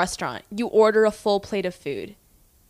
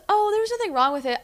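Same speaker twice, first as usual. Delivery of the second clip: high, breezy and dismissive; the customer explaining there was nothing wrong with the drink; airy customer voice; 12 semitones higher than usual. Retold or imitated. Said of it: "She goes".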